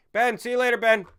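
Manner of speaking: loud, high-pitched send-off; calling out goodbye. Real, not acted. Streamer seeing off a departing viewer, calling out. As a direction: holler a farewell.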